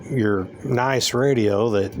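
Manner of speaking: usual speaking voice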